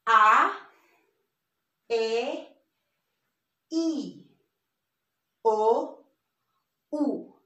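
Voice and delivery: A, E, I, O, U as ah, eh, ee, oh, oo; Spanish vowel sounds. Shown as a demonstration. Teacher goes through the sounds again, spaced Spanish vowels. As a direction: give each pure vowel alone, pausing between. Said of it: "let's go through the sounds".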